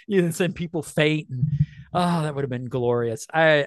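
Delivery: ordinary talk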